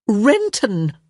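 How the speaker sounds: loud exasperated cry of the name; mother exclaiming the name at her distracted daughter. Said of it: "Violet exclaimed".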